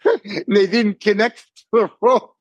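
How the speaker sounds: high, words breaking into laughter; laughing. Real, not acted. Telling friends the rope wasn't connected, laughing. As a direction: barely get the punchline out for laughing. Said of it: "I'm sorry, I'm laughing".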